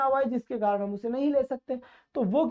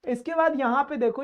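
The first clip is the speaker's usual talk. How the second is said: ordinary talk, nothing marked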